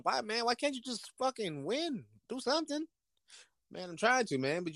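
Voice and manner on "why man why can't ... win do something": high mock owner whine. Owner yelling at quarterback.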